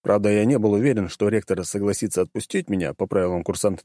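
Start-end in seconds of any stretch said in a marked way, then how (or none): none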